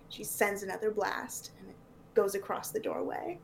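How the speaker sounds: ordinary talk, nothing marked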